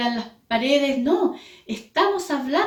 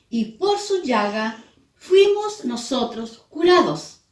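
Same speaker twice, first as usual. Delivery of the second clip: solemn scripture reading; 2 semitones higher than usual. Acted. Preacher reading Isaiah to the church, solemn and rising. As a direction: proclaim the passage with grave fervor.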